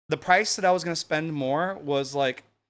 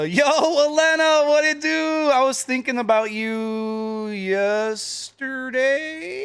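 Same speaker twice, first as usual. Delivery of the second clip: squeaky high greeting, slowed; high silly greeting voice; 10 semitones higher, 5 dB louder, much slower than usual. Acted. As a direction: lilt up high and drawn out. Greeting a viewer in a put-on high voice.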